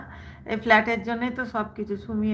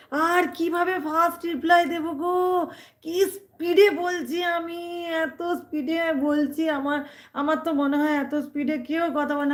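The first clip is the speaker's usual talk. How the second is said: pitched up high, protesting how fast she already reads; exasperated high protest; 6 semitones higher than usual. Real, not acted. To a commenter asking for first replies, exasperated high protest. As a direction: toss the complaint out high and fast, half laughing in disbelief.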